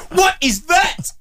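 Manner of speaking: high, slow, hammed-up shriek of disbelief; startled horror. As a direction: imitate a passenger jolted from half-sleep, aghast. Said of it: "She jolts back in her chair and turns to me and goes".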